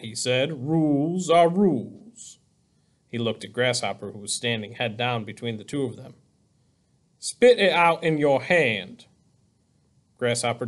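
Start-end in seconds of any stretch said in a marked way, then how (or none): 0.7-2.4 slow, drawn-out official voice; measured figure of authority; superintendent's pronouncement. A narrator's reading. Superintendent states the rule, character voice. 7.2-9.1 slow, drawn-out command; measured official voice giving orders; superintendent's order. A narrator's reading. Superintendent orders boy, character voice.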